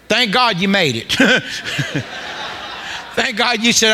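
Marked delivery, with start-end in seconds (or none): 1.2-3.1 soft, breathy chuckle; laugh. Real, not acted. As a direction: laugh quietly at your own remark. Laughs at own remark.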